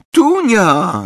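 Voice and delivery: high, loud call of a sister's name; a startled, happy shout across the garden; surprised glad call. A narrator's reading. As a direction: call out, caught off guard but pleased. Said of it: "zawołała Lili, zaskoczona, ale i ucieszona".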